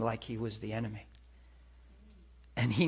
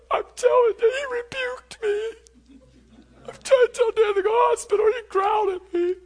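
high, loud, tearful complaint; wailed protest; 8 dB louder than usual. Retold or imitated. Man reporting God's rebuke to others, wailed high and loud. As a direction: wail it far above your usual pitch, loud.

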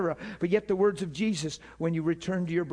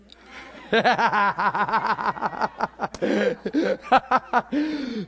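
long, loud rolling laugh; helpless belly laugh; real laughter; 4 semitones higher, 5 dB louder than usual. Real, not acted. Preacher amused that God knows the word, laughing hard.

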